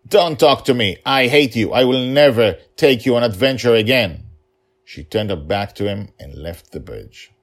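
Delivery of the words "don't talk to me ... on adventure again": put-on angry child shout.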